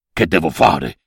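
puzzled low donkey. Acted. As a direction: drop deep for the dumb donkey. Donkey answers the girl.

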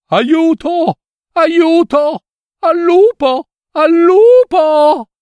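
high, loud cry for help at the top of his lungs; shouting for help. Acted. Shepherd boy falsely crying wolf to the village, yelling. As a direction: yell it out as loud as you can, pitch way up. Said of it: "gridò a squarciagola".

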